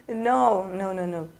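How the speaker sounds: ordinary delivery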